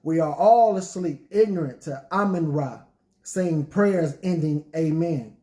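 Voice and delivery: rhythmic spoken word delivery; poetry reading. Acted. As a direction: perform it like a spoken word poet. Recites his poem.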